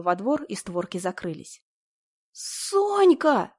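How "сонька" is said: high loud wail. A narrator's reading. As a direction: wail the name out. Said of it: "завыла я".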